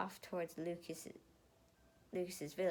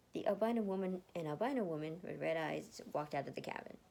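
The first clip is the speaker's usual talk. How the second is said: usual speaking voice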